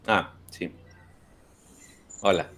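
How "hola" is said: usual speaking voice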